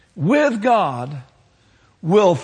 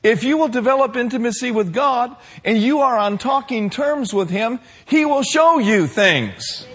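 raised, higher preaching voice building louder; impassioned preaching; 7 semitones higher, 4 dB louder than usual. Real, not acted. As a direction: preach it with mounting force. Preacher builds to full force.

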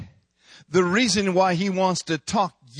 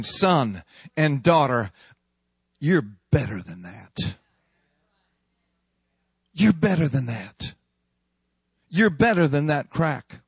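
tender father; 5 semitones lower than usual. Acted. Father calling children back.

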